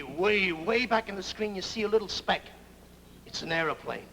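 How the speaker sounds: ordinary talk, nothing marked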